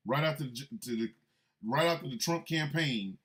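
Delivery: ordinary talk, nothing marked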